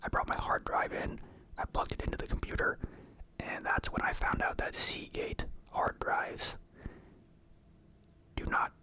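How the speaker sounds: ordinary delivery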